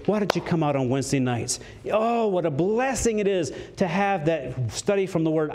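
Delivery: normal talking voice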